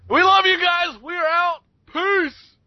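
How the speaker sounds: high, loud goodbye shout; shouted sign-off. Real, not acted. Host shouting goodbye to listeners, loud and high. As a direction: yell the farewell to the whole audience.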